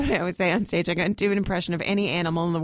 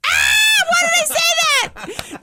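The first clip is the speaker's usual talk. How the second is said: high screamed self-reproach; hammed-up horrified shriek at one's own blunder; mock panic; 8 dB louder, much slower than usual. Retold panic after blurting out.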